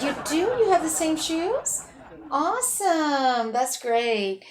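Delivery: mock delight. Mock enthusiastic reply.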